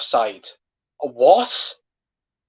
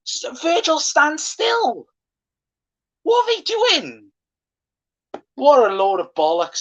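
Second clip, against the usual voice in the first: shrill outraged rant; 11 semitones higher than usual. Real, not acted. Fan ranting about Virgil standing still, very high outraged cry. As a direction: screech your disbelief at the defending.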